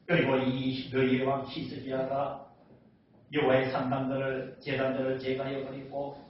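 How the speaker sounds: low, level recitation; scripture reading. Acted. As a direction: read the verse out on one steady note. Reads scripture aloud.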